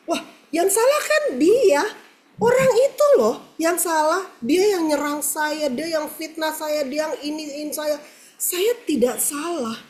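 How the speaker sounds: high, insistent retold protest; indignant protest. (protes tinggi yang tidak terima)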